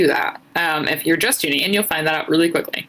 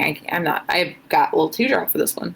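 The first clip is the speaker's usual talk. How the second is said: ordinary talk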